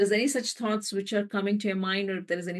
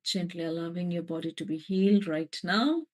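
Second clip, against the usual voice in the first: guided meditation. Acted. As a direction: let the words settle over them. Meditation guidance.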